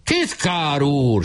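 high slow drawn-out cry; shrieking after. A narrator's reading. Weiss shrieking after Nemecsek, high and drawn out. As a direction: shriek the title after him. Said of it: "süvöltött utána Weiss".

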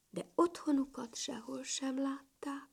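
usual speaking voice

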